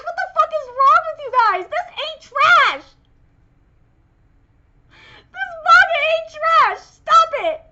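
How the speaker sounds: loud, punchy "ain't trash"; indignant scolding. Real, not acted. Fan scolding viewers who call the manga trash, indignant. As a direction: scold the haters hard. Defending the manga against haters.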